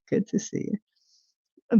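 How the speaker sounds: slow whispered repeat; a slow, breathy whispered greeting. Shown as a demonstration. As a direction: whisper it back slowly.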